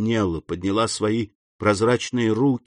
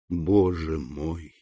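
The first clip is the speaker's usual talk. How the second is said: shaken; 7 semitones lower, 6 dB quieter, much slower than usual. A narrator's reading. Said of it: "Граф содрогнулся при звуке этого голоса".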